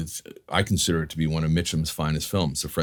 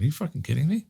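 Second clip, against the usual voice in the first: high, incredulous mock disbelief; 8 semitones higher, 3 dB quieter, faster than usual. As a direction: toss it up in amazed disbelief. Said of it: "He went".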